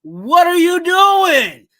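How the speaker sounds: loud, high, slow yell of disbelief. Retold or imitated. Fan yelling at a player on TV, loud slow disbelief. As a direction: bellow it at the screen like a fed-up fan. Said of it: "I'm yelling at 'em and I'm saying".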